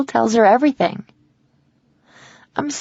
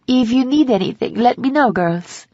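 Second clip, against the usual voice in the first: French housekeeper's higher-pitched offer; French accent; 4 semitones higher than usual. A narrator's reading. Housekeeper leaving the room offers help, French accent. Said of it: "with a heavy French accent".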